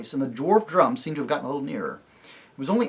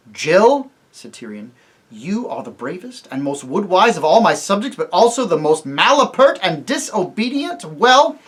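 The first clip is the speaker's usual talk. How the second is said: stern rebuke; 5 semitones higher, 9 dB louder than usual. Acted. King scolding loudly.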